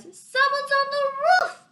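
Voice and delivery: high, loud alarmed call. Acted. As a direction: shout it up at the roof. Crowd member calling out.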